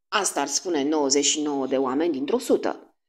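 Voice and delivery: ordinary delivery, nothing marked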